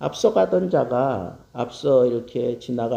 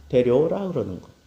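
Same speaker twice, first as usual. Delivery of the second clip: ordinary talk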